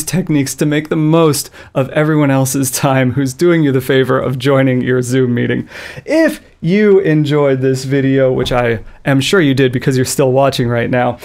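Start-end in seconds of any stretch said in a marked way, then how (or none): none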